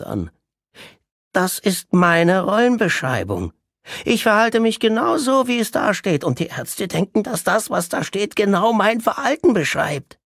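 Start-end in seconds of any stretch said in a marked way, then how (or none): 1.3-10.2 very high, loud, triumphant patient voice; triumphant Orest. Acted. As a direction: gloat over having fooled the doctors. (sehr hoch und triumphierend)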